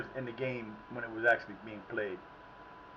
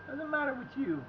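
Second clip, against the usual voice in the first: exasperated yelling; 10 semitones higher than usual. Real, not acted. Scolding a player on the screen.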